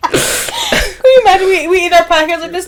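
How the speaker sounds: high voice full of laughter; laughing hard. Real, not acted. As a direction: ask it while cracking up. Helpless laughter at sign-off.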